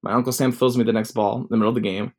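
normal talking voice

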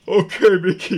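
gasp, then a high put-on voice; tearful consent. Tubbins agrees to let Mickey end it, hammed-up grief. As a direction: give in as a heartbroken friend, hammed-up.

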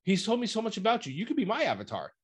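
lightly voiced persuasive goddess; imitating Teruet. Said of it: "And she says in there, like".

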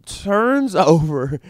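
normal talking voice

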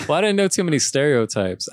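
real embarrassed chuckle while answering; laughing. Real, not acted. Laughs at awkward question.

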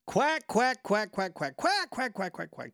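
spoken quacks mimicking a duck call; duck call honks. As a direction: rattle off mock quacks. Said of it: "if you like to just".

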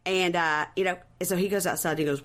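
usual speaking voice

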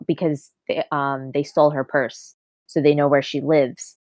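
normal talking voice

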